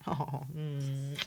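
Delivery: ordinary delivery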